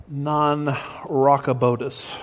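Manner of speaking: drawn-out, slow "non-rockabotus"; deliberate naming. Shown as a demonstration. Preacher quoting Walter Martin's name for the disease, slow deliberate naming. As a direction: say "non-rockabotus" slowly, stretching it out.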